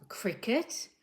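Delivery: usual speaking voice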